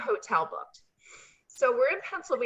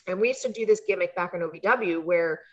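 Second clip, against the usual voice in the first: usual speaking voice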